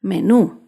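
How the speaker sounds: low, firm "Menú", me-NÚ; stress on last syllable. Shown as a demonstration. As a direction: hit the final NÚ of "Menú". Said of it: "se acentúa en la última sílaba".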